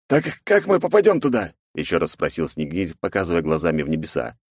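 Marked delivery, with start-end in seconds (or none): 0.1-1.5 put-on higher chatty young guy; young buddy voice. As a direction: ask like a curious friend. Snegir asking.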